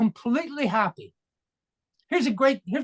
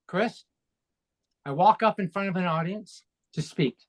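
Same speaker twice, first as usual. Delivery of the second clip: ordinary talk, nothing marked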